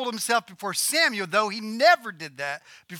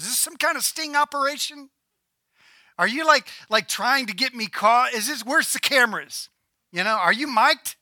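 mock-suspicious medium, raised voice; suspicious questioning; 5 semitones higher, 4 dB louder than usual. Witch quizzing her visitor about a sting operation, comically suspicious. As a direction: act out comic paranoia, pitched up.

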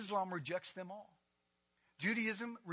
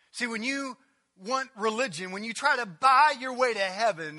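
louder, pushing sermon voice; full-force preaching; 5 semitones higher, 14 dB louder than usual. Real, not acted. Preacher warning the congregation about buying heaven, loud. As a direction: hammer the point about religion.

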